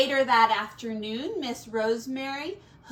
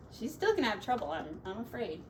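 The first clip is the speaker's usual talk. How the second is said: plain reading voice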